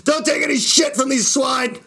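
hammed-up drunk cheerleader voice; drunk cheering. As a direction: whoop him on like a tipsy heckler at the back. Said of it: "sneaking hits off a flask and be like".

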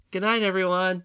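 cheerful sign-off. Acted. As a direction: call out goodbye to the audience.

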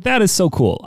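excited. Retold or imitated. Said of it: "And I was like".

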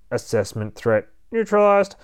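high-pitched robot readout; high little robot voice; little blue robot. Acted. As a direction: report like a small machine. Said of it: "says the little blue robot".

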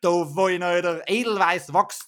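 hammed-up South Tyrolean dialect; folksy South Tyrolean, a bit loud; South Tyrolean accent. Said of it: "nicht Hochdeutsch, Südtiroler Volk".

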